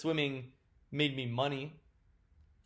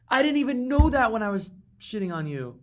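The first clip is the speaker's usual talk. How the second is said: higher and louder outcry; shocked laughter-edge; 9 semitones higher, 7 dB louder than usual. Real, not acted. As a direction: blurt it while still reeling. Realizes the bench would have won.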